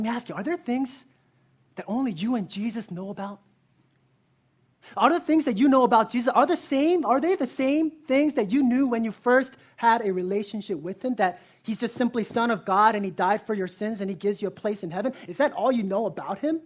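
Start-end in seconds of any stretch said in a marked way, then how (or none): 5.0-16.6 loud, driving preacher's build; impassioned pulpit crescendo; full-force preaching. Acted. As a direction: press the congregation hard. Climactic sermon appeal.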